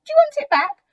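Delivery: very high, loud mouse question; squeaky-high story-book mouse; little mouse. A narrator's reading. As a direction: offer it in a tiny, eager mouse voice. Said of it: "answered the mouse".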